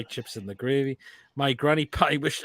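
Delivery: normal talking voice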